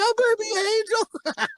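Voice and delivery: put-on high, slow cooing baby voice; mocking his brother's doting coo; doting baby talk. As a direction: coo it like he adores the dog. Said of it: "he's all like".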